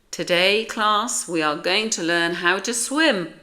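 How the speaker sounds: loud, projected announcement to a class; emphatic, carrying classroom voice; teacher's announcement. A narrator's reading. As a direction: announce it brightly to a roomful of pupils. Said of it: "made an announcement".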